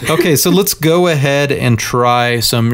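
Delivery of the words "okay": ordinary talk, nothing marked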